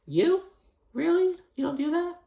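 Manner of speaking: very high, squeaky sarcastic disbelief; a shrill mock gasp of fake surprise; mock incredulous Larsa. Podcaster mocking Larsa's fake shock, very high. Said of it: "And Larsa's like".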